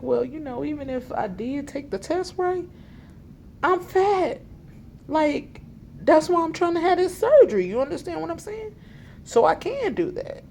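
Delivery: high, loud indignant retort, rising as it goes; exasperated retort. Retold or imitated. Retelling her own exasperated reply.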